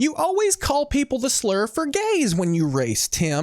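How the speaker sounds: very high put-on wife voice; mock nagging wife. Wife to husband about his racing, mock nagging. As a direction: nag in a hammed-up high pitch.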